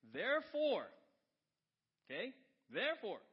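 loud, high pulpit proclamation; ringing sermon shout; full-force preaching. Acted. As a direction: punch it out like a preacher.

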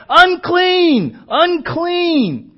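high, loud drawn-out warning cry. A narrator's reading. Leprous person warning others away, crying out. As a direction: cry each word out, high and long. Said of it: "and cry out".

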